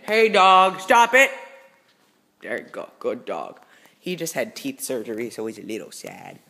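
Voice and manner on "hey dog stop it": loud, sharp call to the dog; firm loud command at the dog; scolding the dog. Real, not acted. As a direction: stop the dog from jumping.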